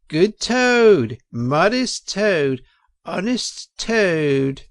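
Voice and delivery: high, loud, slow sarcastic praise; sarcastic weasel. A narrator's reading. Weasel sneering at Toad for the crowd, high and slow. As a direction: lay the sarcasm on thick. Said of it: "a voice could be made out saying".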